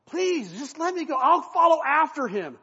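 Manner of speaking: eager runner begging his commander. Acted. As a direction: beg to be let go. Ahimaaz pleading with Joab.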